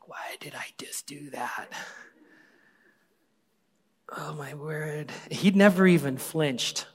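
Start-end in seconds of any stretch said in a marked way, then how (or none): 0.1-1.7 hushed self-questioning whisper; quiet incredulous whisper to oneself; whispered disbelief. Retold or imitated. Retold disbelief at own act. 4.2-5.1 whispered exclamation. Real, not acted. Reaction to gross moment.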